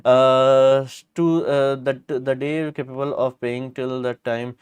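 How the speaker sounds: normal talking voice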